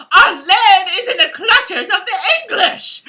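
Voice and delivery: loud, high, hammed-up Scottish cry; badly faked Scottish battle speech, laughing; bad Scottish accent. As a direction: declaim it in an overblown Scottish accent. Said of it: "I can't do the Scottish accent very well".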